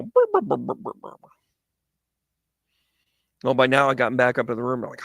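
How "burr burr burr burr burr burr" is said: high buzzing burr, fading to a whispered rattle; imitated earbud buzz. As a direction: mimic the earbuds vibrating. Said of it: "hear the earbuds go".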